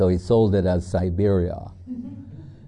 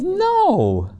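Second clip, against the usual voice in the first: squeaky high lady's no; a high, amused flat denial; imitating the lady's high no; mock high-pitched no. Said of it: "She goes".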